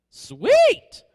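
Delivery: high, loud burst of "Sweet!"; delighted exclamation. Retold or imitated. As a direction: blurt it out thrilled. Priest's delighted reply to convert.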